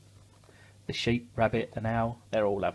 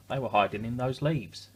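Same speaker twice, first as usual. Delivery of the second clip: plain reading voice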